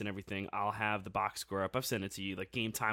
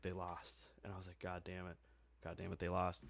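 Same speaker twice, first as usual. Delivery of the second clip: muttered despair; 5 semitones lower, 13 dB quieter than usual. Retold or imitated. Retelling despair at apparent loss.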